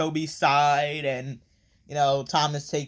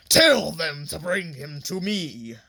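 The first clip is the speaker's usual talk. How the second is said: slow, put-on villain voice; hammed-up evil boss giving orders, drawn out; villain's command; 2 semitones higher than usual. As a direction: order a henchman like a cartoon villain. Villain's order acted out in retelling.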